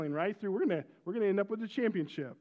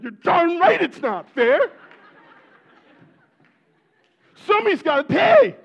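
high, loud furious shout; dad rages at the unfair calls, shouting high and loud; angry shout; 10 semitones higher, 15 dB louder than usual. Retold or imitated. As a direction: bellow it like a dad ready to fight the refs. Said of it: "I'm like".

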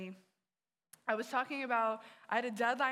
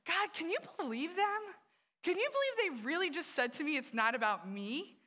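higher, louder, outraged venting to God; can you believe them, pitched way up; indignant complaint; 7 semitones higher, 4 dB louder than usual. Retold or imitated. Telling God about her friends, in high indignant outrage. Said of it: "So I walked away really mad".

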